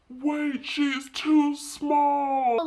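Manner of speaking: slow mock call of a friend somewhere off to the side; imitating Brianna. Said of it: "I remember Brianna's voice in the background".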